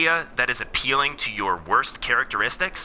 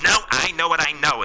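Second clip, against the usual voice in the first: higher-pitched mock indignant objection; stubborn refusal; 6 semitones higher, 4 dB louder than usual. Progressive people refusing to look at the transcript, mock stubborn. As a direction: imitate someone stubbornly refusing to listen. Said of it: "stomp their feet".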